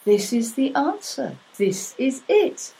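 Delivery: lightly voiced goddess, delighted and amazed; a hint of a wondering goddess. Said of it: "And the Elohim said".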